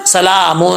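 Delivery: سَلَامٌ ending in a hidden nasal; ikhfa. Shown as a demonstration. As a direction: soften the tanween of سَلَامٌ into a nasal. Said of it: "اخفا ہو رہا ہے نون تنوین کا".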